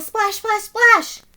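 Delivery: plain reading voice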